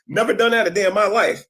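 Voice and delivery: said through laughter, pitched high; laughing denial. Real, not acted. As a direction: deny it while still laughing.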